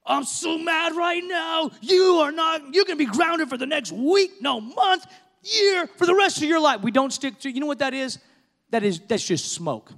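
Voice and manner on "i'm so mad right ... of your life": shouting, escalating punishments; angry parent. Retold or imitated. Parent yelling an overblown grounding at a child in anger. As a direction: blow up at the kid mid-sentence. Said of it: "out of reaction or out of anger".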